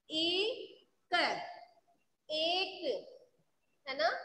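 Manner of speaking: usual speaking voice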